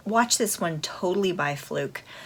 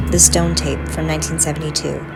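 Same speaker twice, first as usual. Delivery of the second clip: louder trailer voice over music; announcer read; 8 dB louder than usual. Acted. Reads synopsis over music.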